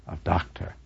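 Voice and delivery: hushed whispered ending. A narrator's reading. Narrator closing the first part of the tragedy, whispering. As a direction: breathe the title out, slowly.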